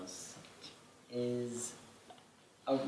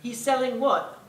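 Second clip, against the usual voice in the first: high, louder, incredulous official voice; baffled official; 9 semitones higher, 4 dB louder than usual. Retold or imitated. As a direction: play a baffled British regulator. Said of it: "British Food and Drug Administration would be like".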